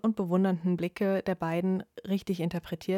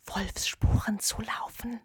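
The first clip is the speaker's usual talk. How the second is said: slow hushed whisper; 3 semitones higher, 3 dB quieter, slower than usual. Acted. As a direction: whisper it like a secret. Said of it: "jetzt flüsterte er nur noch". (flüsternd, langsam und geheimnisvoll)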